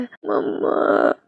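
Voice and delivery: put-on slow, drowsy little child's call; sleepy child. A narrator's reading. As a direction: call out half asleep. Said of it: "पर तभी जुन उठता है". (नींद में बच्चे की पुकार)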